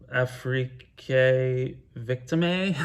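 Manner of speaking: a half-joking French accent on the album title; playful French-accented title. Shown as a demonstration. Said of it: "I don't know why I'm making it French".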